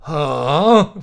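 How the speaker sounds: a loud, startled huh; a dazed, startled blurt. Acted. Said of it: "a sigh-like response".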